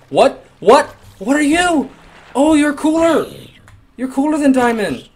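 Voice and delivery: high, loud excited exclaiming; amazed shouting. Real, not acted. Player meets an unknown creature, amazed shouting. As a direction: cry out in high-pitched amazement.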